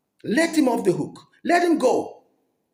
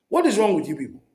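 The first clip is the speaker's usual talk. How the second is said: ordinary talk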